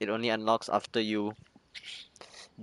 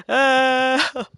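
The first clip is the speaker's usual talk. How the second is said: sudden high yelp; startled yelp; 14 semitones higher, 9 dB louder than usual. Real, not acted. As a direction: yelp in surprise. Startled in game.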